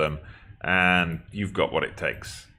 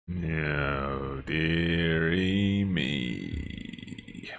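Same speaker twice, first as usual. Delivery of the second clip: very slow, long-stretched lament; long, sinking groan of disappointment; drawn-out dismay; 4 dB quieter, much slower than usual. Real, not acted. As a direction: stretch each word out in weary dismay. Dismay at failing to qualify.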